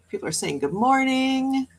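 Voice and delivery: ordinary talk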